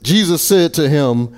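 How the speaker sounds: usual speaking voice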